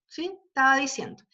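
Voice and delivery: ordinary talk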